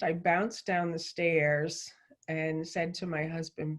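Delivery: usual speaking voice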